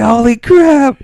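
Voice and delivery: sudden high shout of disbelief; shocked. Retold or imitated. Viewer stunned by a golfer's uppercut, shouts. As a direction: yell it out, stunned.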